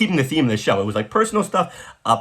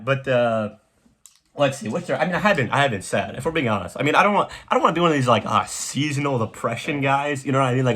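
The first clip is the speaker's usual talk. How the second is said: usual speaking voice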